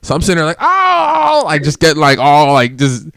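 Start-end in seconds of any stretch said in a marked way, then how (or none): 0.6-1.4 pained cry. Retold or imitated. Champagne sprayed in his eyes.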